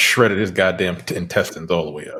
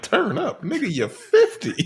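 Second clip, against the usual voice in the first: mock incredulous; 13 semitones higher than usual. Retelling Marcus mocking Mike.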